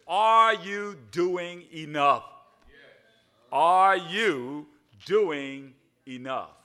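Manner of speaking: slow, loud question, leaning on ARE; slow emphatic question. Shown as a demonstration. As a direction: pose the question slowly and weightily. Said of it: "today's language is a little different".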